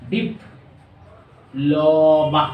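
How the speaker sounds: very slow teacher's drawl on diploma; syllable by syllable. Shown as a demonstration. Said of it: "তিনবার উচ্চারণ". (Diploma ধীরে টেনে টেনে)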